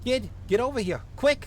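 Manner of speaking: high, urgent Minnesotan cop giving orders; vaguely Minnesotan accent. Acted. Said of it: "give him a vaguely Minnesotan accent".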